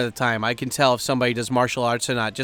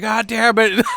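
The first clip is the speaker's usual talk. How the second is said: mock exasperated friend; 9 semitones higher, 4 dB louder, slower than usual. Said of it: "And he's like".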